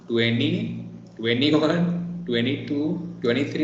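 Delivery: "twenty-one" said tweny-one, no T after N; American twenties. Shown as a demonstration. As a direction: count "twenty-two", "twenty-three" as tweny, T dropped. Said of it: "I'm saying like".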